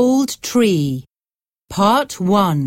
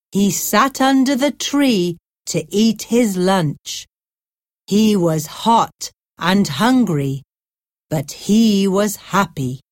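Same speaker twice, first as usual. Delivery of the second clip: ordinary delivery, nothing marked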